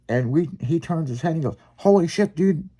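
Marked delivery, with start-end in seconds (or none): 1.8-2.7 higher-pitched mock amazement; retold astonishment. Friend retold spotting deer watching them, astonished. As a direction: imitate a friend suddenly startled.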